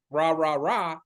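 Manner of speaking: a light hammed-up cheerleader chant; mock cheerleader. Mocking an over-eager cheerleader greeting buyers. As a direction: cheer it like a pep squad. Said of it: "like, a cheerleader".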